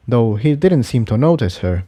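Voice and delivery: ordinary delivery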